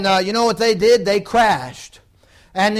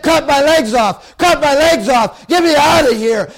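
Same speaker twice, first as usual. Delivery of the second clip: retold pleading shout, louder; desperate shouting; 4 semitones higher, 4 dB louder than usual. Preacher voicing pinned pilot begging to be freed, shouting. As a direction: cry out as if pinned in flames. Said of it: "He said".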